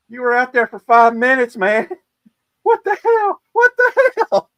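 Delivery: very high, loud disbelieving shout; incredulous yell. Retold or imitated. Shipmates yelling at him in disbelief, very high and loud. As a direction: yell it in shocked disbelief, laughing. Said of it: "everybody's like going".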